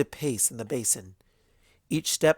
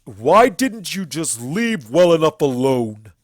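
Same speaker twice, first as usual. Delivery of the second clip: louder, slow, seething stallion tirade; furious stallion accuser; 4 semitones higher, 12 dB louder, slower than usual. Acted. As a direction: condemn the tyrant with contempt.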